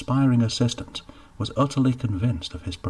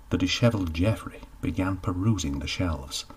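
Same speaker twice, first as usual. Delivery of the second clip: plain reading voice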